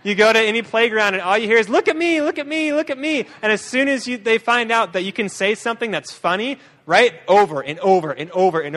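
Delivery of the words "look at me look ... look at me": put-on high, needy little kid calling for attention; higher child's voice, again and again. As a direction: pitch it up like a playground kid begging to be watched. Kids calling out on playground.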